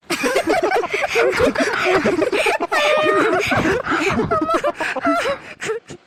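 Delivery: loud, long shouting laughter. Real, not acted. Big laughter. (உரத்த நீண்ட சிரிப்பு)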